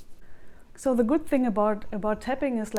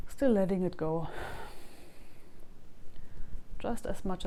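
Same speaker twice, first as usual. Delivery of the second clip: tapping script; 2 semitones lower, 4 dB quieter than usual. Acted. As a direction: say each line for viewers to echo back. Leads a tapping sequence.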